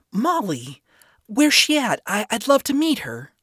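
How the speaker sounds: high, bright eager questions; light up with eagerness; excited. A narrator's reading. Charlie eager.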